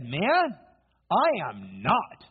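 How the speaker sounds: high "Man", dropping on a slow "I am not"; indignant denial. Acted. Peter answers the one who spotted him, indignantly denying it. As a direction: deny it with drawn-out emphasis. Peter denies it to his accuser.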